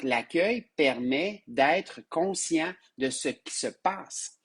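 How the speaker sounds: usual speaking voice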